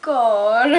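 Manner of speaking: exasperated oh god. Retold or imitated. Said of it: "I'm just like".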